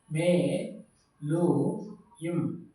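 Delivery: मेलुयम said very slowly, each syllable held; teacher stretches मेलुयम for the class; drawn-out Tamil word. Shown as a demonstration. Teacher repeating the word slowly for students. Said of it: "देख लीजिए".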